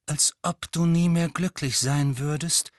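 plain reading voice

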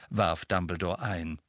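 ordinary delivery

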